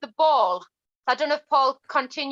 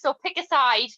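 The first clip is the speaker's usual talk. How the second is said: ordinary talk, nothing marked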